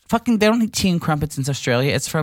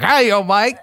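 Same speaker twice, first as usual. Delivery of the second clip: high mock chimney hobo voice; a hobo popping out of a chimney; hammed-up chimney hobo; hobo from Fiddler; 4 semitones higher, 4 dB louder, slower than usual. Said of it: "You sound like a fucking".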